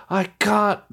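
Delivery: high, drawn-out wail in a mock British accent; Nicolas Cage going British. Fan quoting the sunshine scene, hammed-up British accent. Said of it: "running into the sunshine and he's like".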